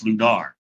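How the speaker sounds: "Lunar" with the last syllable a clear "nar"; "Lunar" as loo-NAR. Shown as a demonstration. Co-host settles the game's name, "Lunar" said loo-NAR. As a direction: end "Lunar" on an open "ar". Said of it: "not Lunar".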